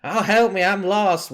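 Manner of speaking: high, loud cry for help; screaming and yelling. Acted. Lost woman calling towards the party from the woods, screaming and yelling. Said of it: "screaming and yelling".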